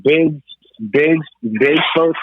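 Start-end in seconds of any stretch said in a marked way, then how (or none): none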